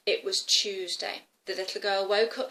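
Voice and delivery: usual speaking voice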